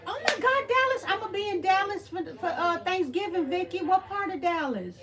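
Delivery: high, loud, excited outburst; real excitement, starting very high and settling a little; thrilled surprise. Real, not acted. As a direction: burst out delighted, then keep eagerly asking. Thrilled viewer is from Dallas.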